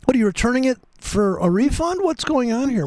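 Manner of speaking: high, mock outraged questions; mock indignant. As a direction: scold the market in disbelief. Scolding the market, mock indignation.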